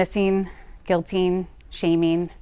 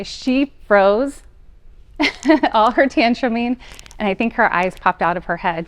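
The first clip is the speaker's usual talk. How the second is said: usual speaking voice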